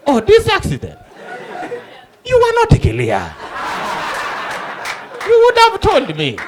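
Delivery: high-pitched mock reproach; defensive realisation. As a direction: shift the blame in a high voice. Witness feigns sudden understanding, blames reporter.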